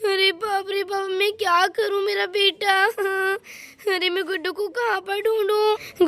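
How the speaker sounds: plain reading voice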